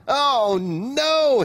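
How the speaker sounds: high, loud storybook wail of dismay. A narrator's reading. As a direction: wail it out in horror. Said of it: "he wailed".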